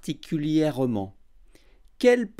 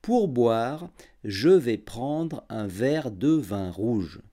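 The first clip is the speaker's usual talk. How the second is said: every syllable clear and unhurried; over enunciating; 5 semitones lower than usual. Shown as a demonstration. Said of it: "j'articulerai bien et je parlerai lentement".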